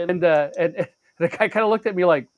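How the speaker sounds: ordinary talk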